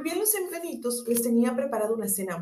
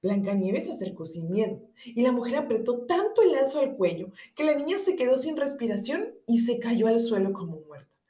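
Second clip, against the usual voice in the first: usual speaking voice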